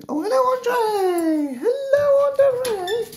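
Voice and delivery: very high, loud cooing at a pet ferret; high pet talk. Real, not acted. Greeting pet ferret in cage.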